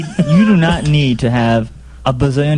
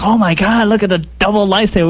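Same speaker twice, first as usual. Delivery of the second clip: higher-pitched acted amazement; excited moviegoer; 6 semitones higher than usual. Fans in the theater at the lightsaber reveal, acted excitement. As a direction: gush like a fan seeing a surprise reveal. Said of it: "we all went crazy".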